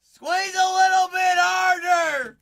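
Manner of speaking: high, loud, slow shouted cheer; cheering a viewer through the toilet strain; mock coaching shout. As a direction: bellow it like a gym coach.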